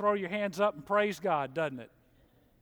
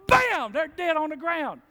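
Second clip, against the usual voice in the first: loud, high, slow bam; slow loud punchline; 10 semitones higher, 8 dB louder than usual. Acted. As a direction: drag out the deadly punchline loudly. Sudden death punchline.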